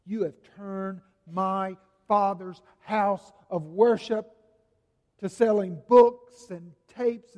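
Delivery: rebuking. Acted. Jesus cleansing the temple.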